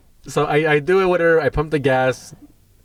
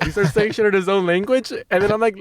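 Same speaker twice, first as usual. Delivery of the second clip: usual speaking voice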